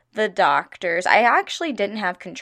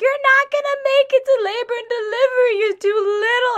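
much higher, crying mock voice of a doom-saying aunt; 5 dB louder than usual. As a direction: squeak it out like a teary, fretting aunt. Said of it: "be like".

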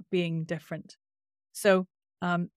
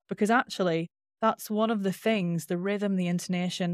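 ordinary talk

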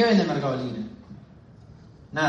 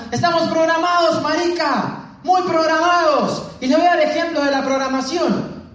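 imitating shouted preaching; 11 semitones higher than usual. Colombian speaker rallying his audience, shouting. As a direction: mimic a fired-up speaker shouting to the crowd. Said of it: "él gritando".

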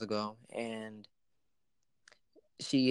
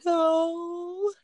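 a hint of a sulky child, pitched higher; little girl's no; 4 dB louder than usual. Uncle imitating his niece refusing the pudding, higher childlike no. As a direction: answer like a sulky five-year-old. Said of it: "she looks at me".

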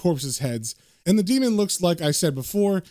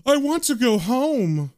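high, slow, whining mock yell; 7 semitones higher, slower than usual. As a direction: wail it like a frightened coward. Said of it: "David is yelling".

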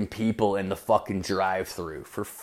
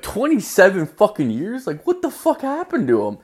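much higher, astonished question; shocked disbelief; 10 semitones higher than usual. Retold or imitated. Comic reacting to his boss, high shocked squeal.

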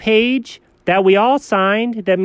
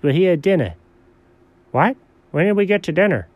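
ordinary talk

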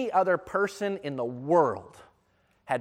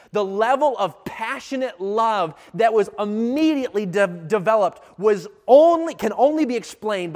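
impassioned preaching; 6 semitones higher, 8 dB louder than usual. Real, not acted. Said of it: "I want you to understand".

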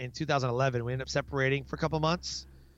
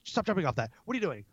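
acted-out scolding, pitched up; hurried, higher mock telling-off; exasperated parent; 3 semitones higher, much faster than usual. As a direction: cut yourself off to correct a child. Parent interrupts own talk to scold.

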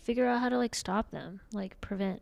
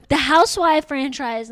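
excited; 6 semitones higher, 8 dB louder than usual. Retold or imitated.

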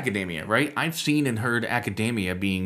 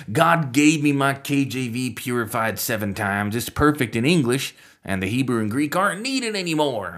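put-on Southern accent; 4 semitones higher, 4 dB louder than usual. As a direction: caricature a Southern King James zealot. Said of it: "I don't know why I go Southern accent".

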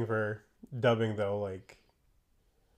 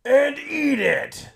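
wildly overacted anime shout; hugely high, loud, drawn-out melodrama; Light Yagami; 10 dB louder, much slower than usual. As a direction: bellow it like a hammy dub actor. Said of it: "he does nothing but overacting".

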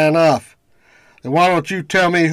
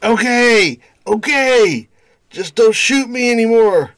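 pained pleading; 9 semitones higher than usual. Acted. Wounded thug gives in.

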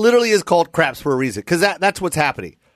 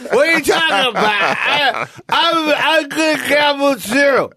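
put-on drunk cooler; 10 semitones higher, slower than usual. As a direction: play the defensive drunk guy. Mock defensive drunk cooler.

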